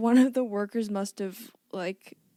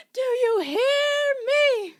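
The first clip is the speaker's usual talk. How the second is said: much higher, louder, slow drawn-out yell; desperate intercom shout; 10 dB louder, much slower than usual. Retold or imitated. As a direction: belt it out like a panicked worker on the intercom. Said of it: "she's like".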